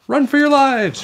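high, loud panicked warning; shout. A narrator's reading. Neil warning the children, panicked shout. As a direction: squeal the alarm to everyone. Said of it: "shouted Neil the Nude".

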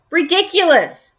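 a high cry of outrage; exclaiming a touch high; cried out. A narrator's reading. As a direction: throw the word up high in disbelief. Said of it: "he cried".

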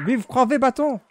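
patriotic cheer. Pretend. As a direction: cheer like a soldier in the ranks. Playful patriotic cheer in game.

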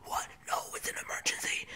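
fast, urgent whisper; lost voice. Acted. Sticks insisting to doctor it's urgent, whispering. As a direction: plead voicelessly in a quick whisper. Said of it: "I think I lost it".

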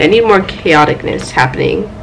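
ordinary talk, nothing marked

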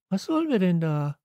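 high-pitched, protesting character voice; Steffi indignant. A narrator's reading. Girl objects to the long trip, indignant.

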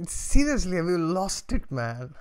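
loud, high-pitched incredulous protest; acted outrage. Storyteller as disgusted neighbour scolding the man, mock outraged. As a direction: play the appalled neighbour, raising your voice.

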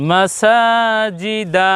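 মাসাজিদা run together with a long-held সা; slow, sustained মাসাজিদা, drawing out সা; chant-like level tone on মাসাজিদা, সা longest; syllables joined, stretched. Shown as a demonstration. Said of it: "সিনকে একটু বেশি করে বলছি".

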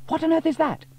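put-on very high, loud girl's voice; baffled by the contraption; girl staring at a strange machine; astonished question. A narrator's reading. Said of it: "asked Sarah".

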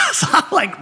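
laughing through the words; cracking up mid-sentence. Real, not acted. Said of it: "rolling laughing".

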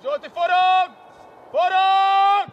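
very loud, high yell; full-voiced coaching shout; shouted command. Real, not acted. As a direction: bellow it across the pitch, building on the repeat.

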